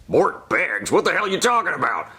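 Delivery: a hint of a boss yelling on the phone; angry boss voice.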